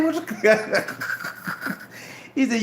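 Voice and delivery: ordinary talk, nothing marked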